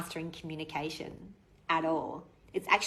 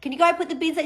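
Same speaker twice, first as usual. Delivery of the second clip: high, loud call up the stairs; loud call up the stairs to her son; calling upstairs; 8 semitones higher, 7 dB louder than usual. Retold or imitated. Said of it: "I can yell up the stairs".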